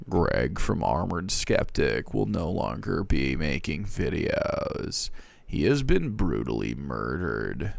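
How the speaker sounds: low, slow, soft grim notice; ominous announcement. Acted. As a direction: read it like a sinister bulletin. Reading Greg's grim tab message.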